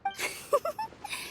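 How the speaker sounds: high, airy little giggle; soft giggle. Acted. Friend amused by the merchant's joke, soft giggle.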